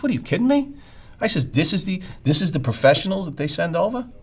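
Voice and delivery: high and loud in mock outrage; incredulous outburst. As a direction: rookie can't believe the negotiator. Retold outraged disbelief.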